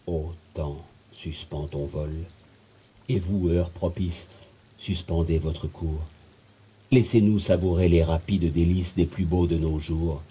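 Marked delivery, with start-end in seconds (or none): 0.1-6.0 soft, solemn invocation; hushed plea. Acted. Beloved voice begging time to stop, hushed. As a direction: drop the volume and implore gently.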